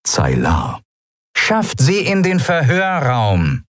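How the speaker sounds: distant loudspeaker order; over a loudspeaker. A narrator's reading. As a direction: command over a tinny intercom. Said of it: "aus einem Lautsprecher".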